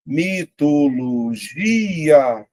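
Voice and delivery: "mitologia" drawn out slowly, GI held longest; syllable by syllable. Shown as a demonstration. Teacher sounding out a word to find its stress, syllable by syllable. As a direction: stretch each syllable of "mitologia", leaning on GI. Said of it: "Vou separar, ó, eu vou falar sozinho".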